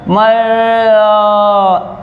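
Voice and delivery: "मर" stretched into a long held "आ"; drawn-out syllable. Shown as a demonstration. Teacher showing the second sound, "मर" drawn out. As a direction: hold the vowel of "मर" on and on. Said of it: "एक sound यहाँ पर आया".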